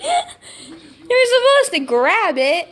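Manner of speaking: slow, high, through laughter; laughing. Real, not acted. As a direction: laugh it out at the dog. Laughing at the dog.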